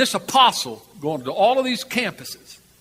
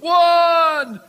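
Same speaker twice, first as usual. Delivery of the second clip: high, loud jeering count breaking into laughter; mocking crowd count; 11 semitones higher, 5 dB louder, much slower than usual. Crowd of five thousand mocking the doomed man, loud jeering count. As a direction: shout the count like a scornful crowd, then cackle. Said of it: "And in mockery".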